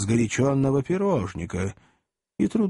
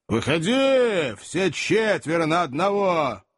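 драчливый вызов; 4 semitones higher, slower than usual. Acted. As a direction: call them out, drawing out the first word. Cabman challenging to fight. (задиристый крик)

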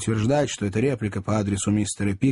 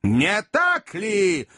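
very slow, high, mocking question; drawn-out sarcastic jab; sarcastic challenge; 8 semitones higher, much slower than usual. A narrator's reading. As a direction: drag out the question with a sneer. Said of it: "улыбка его сделалась ещё более саркастической".

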